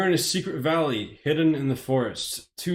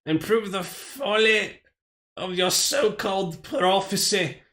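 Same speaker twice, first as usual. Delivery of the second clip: slow, much higher gloating villain voice in a put-on accent; 7 semitones higher than usual. Streamer finishing the beasts' threat, slow high villain accent. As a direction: drag out the threat like a triumphant monster. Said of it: "why am I going in accent?".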